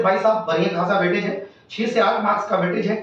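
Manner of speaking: normal talking voice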